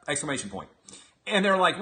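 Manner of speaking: ordinary delivery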